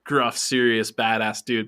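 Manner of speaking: ordinary delivery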